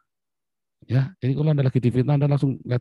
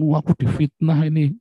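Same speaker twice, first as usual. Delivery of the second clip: mock outraged whine, higher and louder; self-pitying complaint; 6 semitones higher, 7 dB louder than usual. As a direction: ham up the wronged victim.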